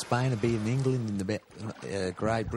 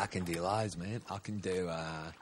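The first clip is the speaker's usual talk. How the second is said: ordinary talk, nothing marked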